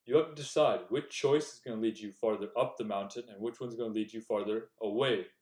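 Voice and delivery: ordinary talk, nothing marked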